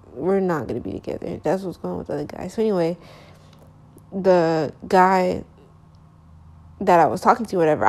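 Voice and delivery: usual speaking voice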